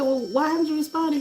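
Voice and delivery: pushing him to explain; pressing him. Retold or imitated. Confronting ex on the phone.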